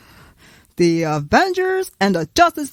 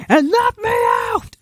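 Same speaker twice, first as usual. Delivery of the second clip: much higher, louder wail; complaint shout; 12 semitones higher, 4 dB louder than usual. Acted. Character left out of the Avengers talk, high wail. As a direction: cry out about being left out.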